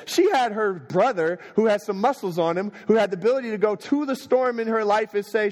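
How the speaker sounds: high, impassioned preacher building to a peak; fervent preaching. Acted. Preacher telling the church how Jesus calmed the storm, fervent. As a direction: preach it out, stirring the congregation.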